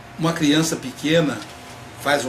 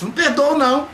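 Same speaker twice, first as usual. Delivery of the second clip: high, louder mock stubborn no; spiteful refusal; 9 semitones higher, 4 dB louder than usual. Imitating a resentful person refusing to forgive, high huffy. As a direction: toss off a higher indignant refusal.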